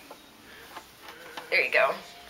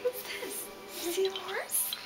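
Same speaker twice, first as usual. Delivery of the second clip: whisper; 7 semitones higher, 8 dB quieter than usual. Real, not acted. Mom whispers to toddler.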